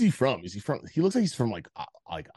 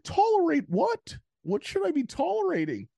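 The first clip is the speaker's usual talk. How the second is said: mock Jordan Peterson. Friend mocks Jordan Peterson crying in an interview, high wailing imitation. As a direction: imitate him getting worked up and whiny. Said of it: "he's talking, he's like".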